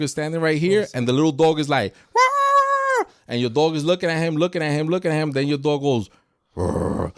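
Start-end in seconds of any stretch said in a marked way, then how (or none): 2.1-3.0 very high yappy bark from a tiny dog; little dog bark. Retold or imitated. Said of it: "Yo, your bark is pretty". 6.6-7.1 big dog bark. Retold or imitated. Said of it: "Yo, your bark is pretty".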